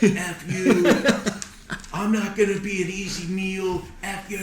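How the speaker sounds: high, hammed-up defiant yell; retold angry screaming. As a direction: act out cursing off a shark, pitched well up. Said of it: "started yelling and screaming at him".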